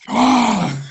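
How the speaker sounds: loud, high fired-up yell; shouting on the pitch. Retold or imitated. Player now shouting on the pitch, loud fired-up yell. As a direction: roar it like a player pumping himself up. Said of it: "you start going".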